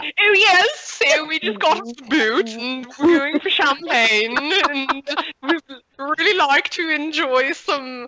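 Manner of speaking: high, slow, hammed-up posh British accent; fake British accent. Player charming shop clerks in fake British accent. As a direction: play the toff fresh off the boat. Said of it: "put on a really fake British accent".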